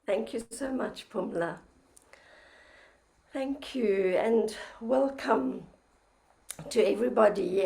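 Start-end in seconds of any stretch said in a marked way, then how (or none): none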